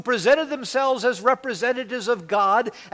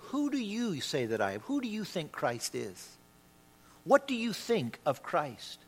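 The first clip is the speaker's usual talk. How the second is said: soft, pointed questions, dropping away; hushed, searching appeal; 2 semitones lower, 8 dB quieter than usual. Acted. Preacher putting Christ's question to all of us, quietly. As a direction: put it quietly to each listener.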